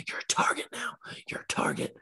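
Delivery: hushed, breathy conspiratorial warning; stoned friend warning him in a paranoid whisper. Retold or imitated. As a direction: whisper it like someone is listening.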